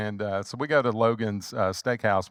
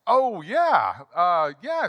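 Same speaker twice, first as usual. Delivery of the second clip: awkward agreeing; 7 semitones higher, 5 dB louder, much slower than usual. Retold or imitated. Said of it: "I was like".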